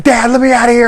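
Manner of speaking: high, loud, panicked plea; freaking out. Retold or imitated. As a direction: beg the father to let him out. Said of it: "he's, like, freaking out".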